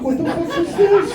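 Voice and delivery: mock outrage. Comic outraged rhetorical question.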